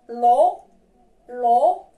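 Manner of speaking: "L'eau" said as a single syllable lo; model pronunciation. Shown as a demonstration. Teacher asks friends to repeat "L'eau", clear model. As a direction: give "L'eau" as a clear lo sound. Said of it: "Répétez avec moi, mes amis".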